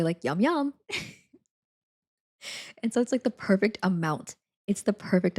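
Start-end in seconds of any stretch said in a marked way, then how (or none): 0.2-0.7 high-pitched mimicked catchphrase; Zhrink. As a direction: imitate a streamer's catchphrase, swooping up high. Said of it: "you hear Zhrink say".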